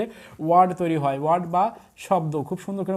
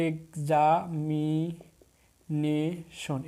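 very slow, examination split into long separate syllables; much slower than usual. Shown as a demonstration. Teacher counting syllables for students, word broken up slowly. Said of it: "শব্দকে ভেঙে ভেঙে উচ্চারণ".

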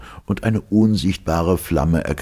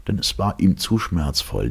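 plain reading voice

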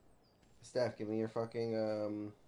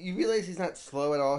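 excited; 6 semitones higher, 7 dB louder than usual. Real, not acted. Excited realization.

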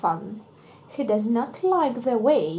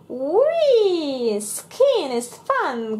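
high whee, slow delight; excited child; 6 semitones higher, 3 dB louder, much slower than usual. Acted. Pepper skis down the baby slope, excited. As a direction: squeal with joy. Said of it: "cries Pepper".

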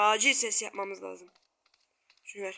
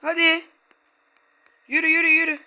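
higher, louder push; urgent urging; 5 semitones higher, 4 dB louder than usual. Real, not acted. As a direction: hurry them along. Urging character on.

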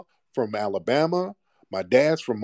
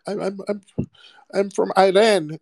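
higher, hesitant mock voice of someone dodging the question; mock stammering answer; 8 semitones higher than usual. Host mimicking a dodgy guest, higher stammering mock voice. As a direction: play the caught liar stammering out where he's from.

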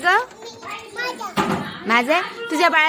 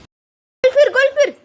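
high, bright singsong call, much higher and louder at first; excited coaxing; 10 semitones higher, 4 dB louder, slower than usual. Real, not acted. Mother urging her small daughter to twirl in her dress, high excited singsong.

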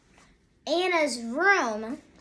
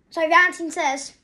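ordinary delivery

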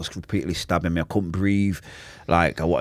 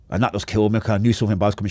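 normal talking voice

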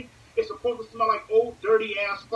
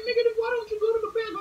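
much higher, fast incredulous question; friend's reply; 13 semitones higher, much faster than usual. Retold or imitated. Friend asking why not go, high fast disbelief. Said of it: "He's looking like".

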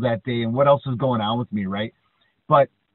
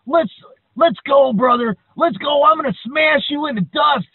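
much higher, louder hammed-up wrestler challenge; wrestler challenge shout; 13 semitones higher, 9 dB louder than usual. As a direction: bellow a ring challenge. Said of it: "He's like".

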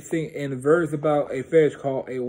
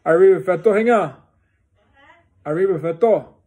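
loud call across the room; 4 semitones higher, 8 dB louder, faster than usual. Real, not acted. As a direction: call out to someone nearby. Calling out to someone.

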